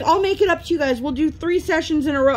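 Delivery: high apologetic teacher; lightly voiced sorry teacher. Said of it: "She's like".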